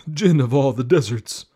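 high, loud, eager horse. Acted. Horse speaks to the Jinn.

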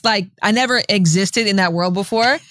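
usual speaking voice

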